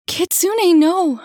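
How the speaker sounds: very high, very loud cry of no; alarmed shout. Acted. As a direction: scream to stop him. Mother shouts to stop him.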